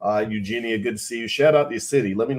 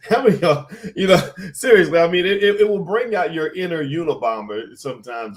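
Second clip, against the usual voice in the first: amused, voice pitched up with laughter; real laughing while talking; 7 semitones higher, 5 dB louder than usual. Real, not acted. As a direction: laugh through the words, high and loud. Laughs at relating to hating work.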